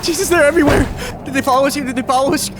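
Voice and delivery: breathless terror at the swarm; panicked. Acted. Survivor panics at zombie swarm.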